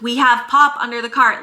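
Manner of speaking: yelled back. Retold or imitated. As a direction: yell it back so she can hear. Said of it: "yelled it back to her".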